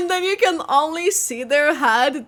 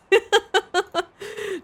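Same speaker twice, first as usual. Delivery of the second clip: giggle, amused; 6 semitones higher than usual. Real, not acted. Laughs at image.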